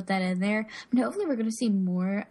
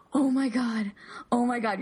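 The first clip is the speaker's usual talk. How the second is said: big gasp, then awed exclamations; mock overwhelmed fan, gasping first; fangirling; 2 semitones higher than usual. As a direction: act out a starstruck fan's gasping reaction.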